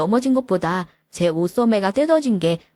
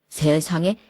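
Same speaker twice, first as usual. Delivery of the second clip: usual speaking voice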